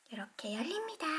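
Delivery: hushed unvoiced aside; a little secret whisper. Real, not acted. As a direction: breathe it out softly.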